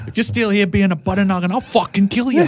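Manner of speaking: high, hammed-up threat; mock-tough buddy threatening a friend; movie quote. Quoting Good Will Hunting scene.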